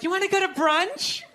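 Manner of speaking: a hint of a sweet sing-song invitation; mock girlfriend voice. Girlfriend inviting her boyfriend to brunch, lightly sing-song. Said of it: "they'll be like".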